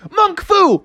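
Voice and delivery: very high karate yell, mock-heroic; martial-arts battle cry. Frog attacking shark, high battle cry. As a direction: cry out high like a cartoon fighter.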